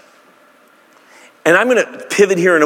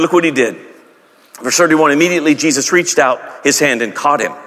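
normal talking voice

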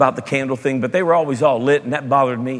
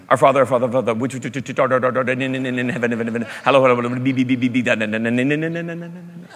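mumbled rote prayer; 2 semitones lower, faster than usual. Retold or imitated. Pastor mimicking his childhood rote praying, mumbled and trailing off. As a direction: gabble the words like meaningless filler. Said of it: "I'd say".

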